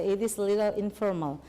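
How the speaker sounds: ordinary talk, nothing marked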